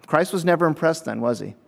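ordinary delivery

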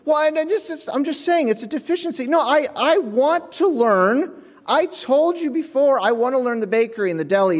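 mock-earnest employee; pleading. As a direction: beg to be taught. Arguing with manager in retold story.

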